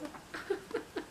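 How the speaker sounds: petit rire soufflé, sans voix; rire étouffé. Real, not acted. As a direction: laisse échapper un petit rire nerveux. Livres qui ont failli tomber. (petit rire étouffé après avoir failli tout faire tomber)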